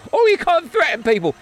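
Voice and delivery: imitating a jittery colleague who objects; mock nervous colleague. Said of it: "People will get nervous here at TalkSport going".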